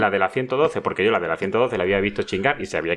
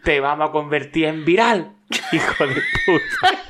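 high, raised prank-caller shouting; hammed-up drunken threat, pitched well up; retold phone taunting; 7 semitones higher than usual. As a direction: taunt the victim down the line, stretching the insults. Re-enacts drunken friend's phone taunts.

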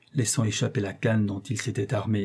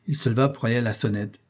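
usual speaking voice

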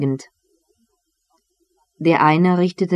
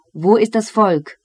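mild narrated call, pitched higher; 5 semitones higher than usual. A narrator's reading. Christer Nilsson Vasa looking for the people, lightly calling out. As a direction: call the question out, lifting the pitch. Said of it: "rief Christer Nilsson Vasa".

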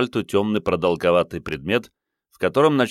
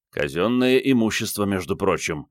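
plain reading voice

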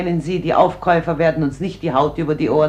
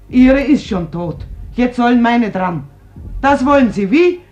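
louder and higher, bitter and furious; angry outburst; 5 semitones higher, 8 dB louder than usual. Acted. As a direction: lash out at the intruder, voice rising. Mother lashes out at visitor. (wütend und laut, bitter anklagend)

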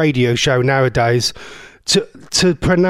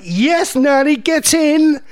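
cheering; 10 semitones higher, slower than usual. Pretend. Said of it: "I didn't say that 'cause I wasn't".

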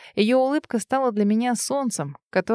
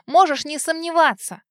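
desperate shout; 8 semitones higher than usual. A narrator's reading. Continuing her desperate shout.

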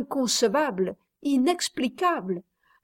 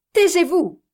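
gritos agudos y fuertes; angry cry; 7 semitones higher, 6 dB louder than usual. A narrator's reading. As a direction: Reine cries out louder and higher. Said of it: "exclama Reine".

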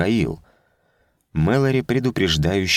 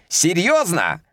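mocking; 11 semitones higher than usual. A narrator's reading. Officers mock over laughter.

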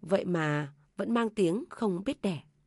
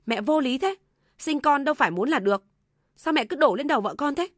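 louder, higher indignant son; angry protest; 6 semitones higher, 6 dB louder than usual. A narrator's reading. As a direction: push back at mother in a raised voice. Son defends wife to mother. (con trai cao giọng bất bình bênh vợ)

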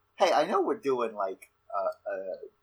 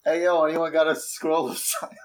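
calling out; 5 semitones higher, 5 dB louder than usual. Acted. Calling out in the market.

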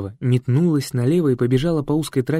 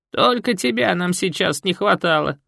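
put-on grumpy old woman; 7 semitones higher than usual. Марфа scolds her daughter and unwelcome guests, grumpy. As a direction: grumble like a sour old woman. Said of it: "поморщилась мать Елены".

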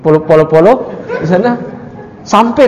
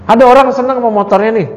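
ordinary talk, nothing marked

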